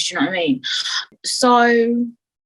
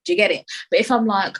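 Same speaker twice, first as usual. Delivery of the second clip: normal talking voice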